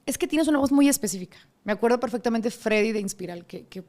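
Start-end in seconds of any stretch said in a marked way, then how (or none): 0.1-1.4 rechazo amable imitado, mucho más agudo; rechazo cortés imitado. Retold or imitated. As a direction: imita el "sí, gracias" que te despide. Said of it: "y me decían". (rechazo cortés imitado, agudo)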